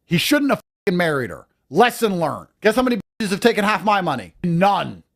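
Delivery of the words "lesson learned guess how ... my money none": usual speaking voice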